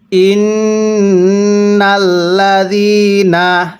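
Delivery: slow, drawn-out tajweed chanting; slow Quran recitation. Shown as a demonstration. Teacher demonstrating recitation to students, slow chant. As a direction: recite each syllable long and measured. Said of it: "কিভাবে পড়ব?".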